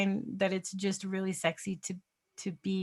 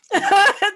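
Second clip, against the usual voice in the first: high loud laugh; loud high laugh at her own joke; burst of laughter; 14 semitones higher, 13 dB louder than usual. Real, not acted. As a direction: crack up loudly and high.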